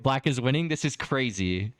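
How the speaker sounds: usual speaking voice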